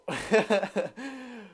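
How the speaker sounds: quiet chuckle; amused laugh. Real, not acted. Streamer amused by a viewer's lookalike comment, quiet laugh. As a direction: chuckle softly to yourself.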